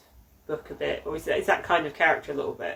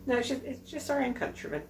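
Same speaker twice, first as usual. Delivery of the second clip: quoted English locals, voice raised higher; lightly put-on voice, higher pitch; mock indignant locals; 7 semitones higher than usual. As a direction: play the offended Englishman protesting their innocence. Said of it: "They're like".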